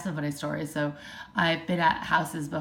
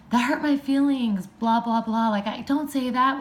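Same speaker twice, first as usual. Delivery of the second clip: higher, louder hurt yell, half-acted; mock yell back; 6 semitones higher, 4 dB louder than usual. Parent yelling back at a child who said I hate you, raised mock yell. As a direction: throw a wounded yell back at the kid. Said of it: "you're yelling back at them".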